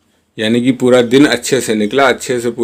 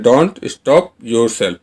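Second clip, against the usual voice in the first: slow model sentence; much slower than usual. Shown as a demonstration. Teacher modelling the English line for Hindi learners, very slow. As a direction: say each word slowly and clearly. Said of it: "teacher repeats model sentence".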